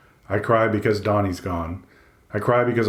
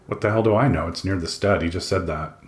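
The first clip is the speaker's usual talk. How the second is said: ordinary delivery, nothing marked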